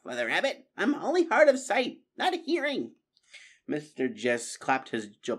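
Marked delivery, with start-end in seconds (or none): 0.0-2.9 high, put-out character voice; higher-pitched story character, mildly affronted; indignant protest. Acted.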